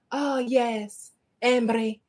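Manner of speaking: imitated Scottish accent on Emery; lightly put-on Scottish lilt; Scottish lady. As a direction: answer like a Scottish woman savouring the name. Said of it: "when a Scottish person says my last name".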